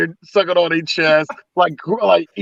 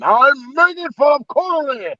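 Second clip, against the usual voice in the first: higher, slow, put-on Scottish accent; mock Scottish accent; 6 semitones higher, slower than usual. Actor questioned about not sounding London, mock Scottish accent. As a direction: lay on a hammed-up Scottish brogue. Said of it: "didn't drop his Scottish accent".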